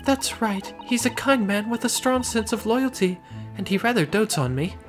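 high-pitched refined lady. Acted. Client describes husband.

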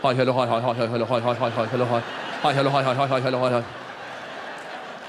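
hint of an Iranian bowing greeting, low and rapid; mock formal greeting. Shown as a demonstration. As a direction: patter the greetings while bowing. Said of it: "That's how we say hi".

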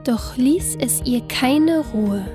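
ordinary delivery, nothing marked

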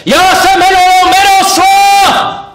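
high, slow, full-throated yell of his name; very loud shout. Acted. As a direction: bellow your own name at them. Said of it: "moc nahlas jsem křičel".

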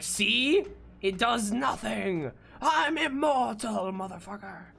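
high, slow, hammed-up gloating villain; mock villain accent. Player imitating the enemy rising back up, mock villain accent. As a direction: taunt them in a put-on accent, drawn out. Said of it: "It's gonna have that accent".

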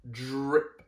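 ordinary delivery

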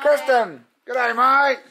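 high, excited dog's voice; high, giddy pet voice; voicing the dog. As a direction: speak as the happy dog greeting everyone. Voicing dog greeting the kids.

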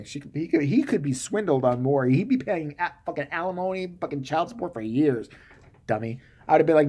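ordinary delivery

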